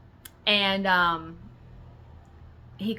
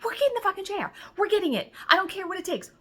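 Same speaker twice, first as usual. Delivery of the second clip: high, emphatic, determined; mock crazed, much higher; crazy-person insistence; 11 semitones higher, faster than usual. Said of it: "I was like a crazy person".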